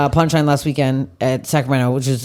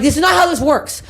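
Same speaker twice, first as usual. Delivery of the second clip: high, indignant lecture; exasperated scolding; 11 semitones higher than usual. Retold or imitated. Retelling scolding the man in the security line, high and indignant. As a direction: explain the rules to an idiot. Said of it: "And I'm like".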